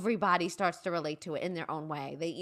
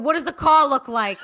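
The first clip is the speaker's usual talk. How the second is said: loud, higher comic father; hammed-up paternal outburst; father demanding; 6 semitones higher, 11 dB louder than usual. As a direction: blurt it out loudly as the worried-about-the-car dad. Father's reaction to crash retold.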